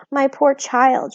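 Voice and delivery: slow tender pity; a hint of tender sorrow. A narrator's reading. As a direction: lean in with pity. Homily tender.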